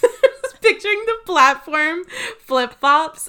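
high, loud, laughing through it. Real, not acted. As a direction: giggle out the words. Laughing while describing vision.

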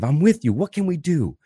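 urgent mock eagerness to help; urgent immediacy. Shown as a demonstration. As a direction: show you're right there with them. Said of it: "but this immediacy of".

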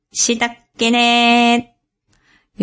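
slow robot sign-off voice; toy robot voice. Shown as a demonstration. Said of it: "ロボット口調で、".

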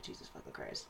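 whispered, laughing under the breath; exasperated whisper. Retold or imitated. Coworker sighing at a pest, whispered laugh. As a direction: breathe it out in disbelief. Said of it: "and I'm like".

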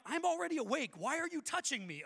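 high, loud mock indignation; exasperated protest. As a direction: object indignantly to being shaken awake. Retold protest at being shaken.

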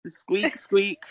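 high squeaky squeak, squeak; mouse squeak. Pretend.

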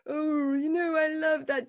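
high, bubbly, ripply little voice; Jane Mansfield. Retold or imitated. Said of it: "warm little ripply, bubbly voice she does".

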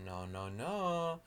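very soft, slow refusal; hushed, drawn-out put-on no; mock bully refusal. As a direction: quietly scold as a pretend bully. Orc bullies refusing, acted.

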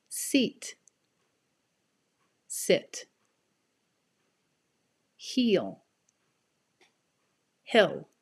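long ee versus short ih in "Seat", "Sit", "Heel", "Hill", "Feel", "Fill", "Sleep", "Slip"; minimal pairs. Shown as a demonstration. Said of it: "I'll start with the word that has the E sound".

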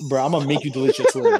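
fast, loud laughing boast; laughing threat. Real, not acted. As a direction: bark the threat through a laugh.

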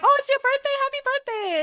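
very high, mock-excited squeal of delight; gushing congratulations. Someone at the bar congratulating her, gushing. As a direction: imitate an over-excited well-wisher.

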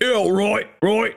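high, chirpy Cockney "Yeah, right, right"; mock Cockney. Imitating a working-class Cockney for a podcast co-host. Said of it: "I guess what you'd call Cockney".

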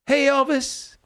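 high, drawn-out hail in an imitated voice; mock flirty greeting. Passing woman greets him, acted.

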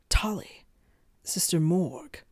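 ordinary delivery

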